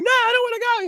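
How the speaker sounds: put-on very high-pitched kid's plea; mock frightened protest. Boy telling his dad he won't fly, mock frightened protest. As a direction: imitate a child balking, pitch way up. Acted child refusing to climb in.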